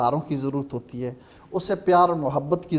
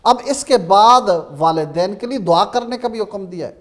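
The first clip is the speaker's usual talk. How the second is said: ordinary talk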